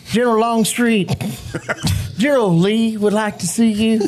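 formal soldier's report; reciting a film line. Retold or imitated. Actor reciting his one line on set, formally. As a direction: deliver the line like a stiff officer. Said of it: "And my line is".

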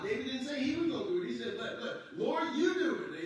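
laughing, high and loud at the end. Real, not acted. Preacher laughing as he retells David's prayer. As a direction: laugh through the punchline.

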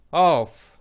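ऑफ as open 'aw' plus f; blended word demo. Shown as a demonstration. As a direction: model ऑफ for learners. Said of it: "और एफ का उच्चारण फ".

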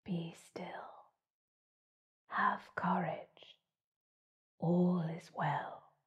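slow, soft breathy whisper; gentle whisper. A narrator's reading. The moon comforting the frightened hare, whispering. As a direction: breathe it like a distant calming spirit. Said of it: "a gentle whisper".

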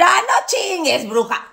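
high, slow disbelieving whine; mock doubtful viewer. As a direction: play a student who can't believe it. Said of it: "Vas a decir". (alumna incrédula, aguda y lenta)

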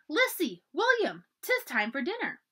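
high, loud call across the river. A narrator's reading. Nan calling Lissy and William in for dinner, loud call. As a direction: shout the children's names down from the bluff. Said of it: "She waved and called down".

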